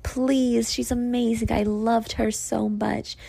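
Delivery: high, whiny-sweet imitation of a begging manager; mock pleading. Mocking manager's plea for candidate.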